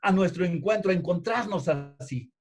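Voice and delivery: fervent sermon delivery; impassioned preaching. Real, not acted. Preaches with raised voice.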